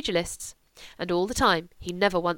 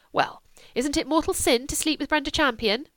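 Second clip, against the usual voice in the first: higher, cheeky challenge; pert retort; 7 semitones higher than usual. Acted. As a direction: throw it back at her. Julia retorts.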